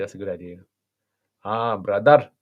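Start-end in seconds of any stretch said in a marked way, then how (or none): 1.4-2.3 louder Bradford Pakistani greeting; Bradford Pakistani accent. Shown as a demonstration. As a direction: hail someone in a put-on Bradford Pakistani accent. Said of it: "Pakistani accent".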